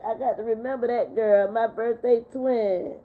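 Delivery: ordinary delivery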